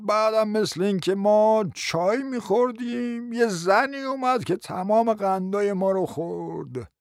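slow, drawn-out recollecting; doddering uncle. Acted. Uncle trying to remember for his nephew, slow and dithering. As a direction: stretch the words as memory crawls along.

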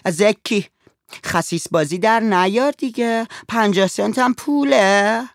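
put-on high, loud, rough girl's voice; rough young woman. A narrator's reading. Girl scolds the stingy father for haggling, high and rough. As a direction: snap at him like a pushy street girl. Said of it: "دختر با خشونت تکانش داد و گفت".

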